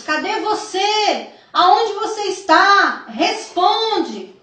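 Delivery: high, drawn-out searching calls; acted calling out. Mothers searching for their children after disaster, acted high calling. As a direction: imitate mothers crying out for lost children. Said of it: "Mães gritavam pelos seus filhos".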